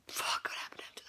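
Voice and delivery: barely voiced whisper, thinking aloud; whispered to herself. Real, not acted. Forgetting the story, whispering to herself. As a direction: whisper it, searching your memory.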